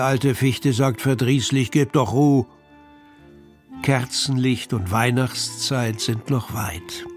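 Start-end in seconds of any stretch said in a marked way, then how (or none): none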